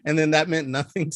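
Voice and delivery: normal talking voice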